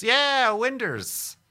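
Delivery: high, loud burst of delight; excited. Real, not acted. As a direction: cheer it out. Excited about windows.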